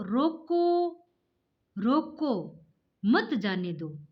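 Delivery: drawn-out रोको, then a clear break before मत जाने दो; pause after रोको. Shown as a demonstration. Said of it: "इसमें भी अलग अलग अर्थ परिवर्तन होगा".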